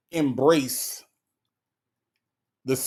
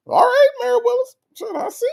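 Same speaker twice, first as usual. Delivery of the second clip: very high, loud teasing call of her name; high teasing squeal; 4 dB louder than usual. Real, not acted. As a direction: tease Mary Willis in a squeal. Teasing a viewer in chat.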